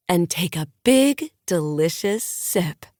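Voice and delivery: slow, savoring sales pitch; lightly voiced guru selling the moment; coaxing influencer. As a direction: draw the crowd into the sip, slowly. Maddie toasts with sponsored water.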